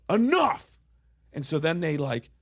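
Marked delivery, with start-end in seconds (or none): 0.1-0.7 high, firm shout of enough; retold shout. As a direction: bark it to break up the fight. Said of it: "And then I go".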